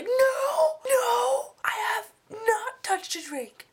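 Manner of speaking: frantic overcompensating denial to the police officer; panicked denial. Retold or imitated. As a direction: overdo the innocence in a frantic wail. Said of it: "But more like".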